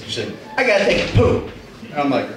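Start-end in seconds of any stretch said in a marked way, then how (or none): 0.5-1.5 put-on higher little-kid voice; small child announcing a need; five-year-old daughter. Daughter tells the babysitter she needs the toilet, child voice. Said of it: "five-year-old daughter comes up and goes".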